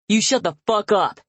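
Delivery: loud, threatening order; warning. Acted. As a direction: snarl the warning. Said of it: "my father warns".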